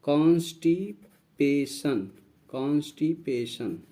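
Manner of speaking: "कॉन्स्टिपेशन" slowly broken as कॉन्स्टि, पे, शन; teacher's drawn-out breakdown of "कॉन्स्टिपेशन", pausing between the parts; syllable-split pronunciation. Shown as a demonstration. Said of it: "इसका pronunciation होगा कॉन्स".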